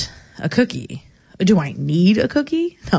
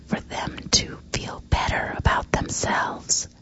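breathy whispered aside, almost a sob; mock sinister whisper; 9 dB quieter than usual. Host whispering a creepy aside to his cohost, hushed. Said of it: "What was that?".

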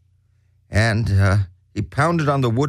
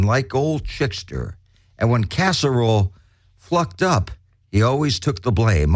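ordinary talk, nothing marked

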